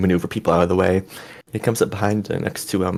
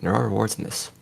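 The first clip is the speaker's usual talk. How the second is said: serious; 3 dB quieter than usual. Acted. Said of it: "he actually gets very serious".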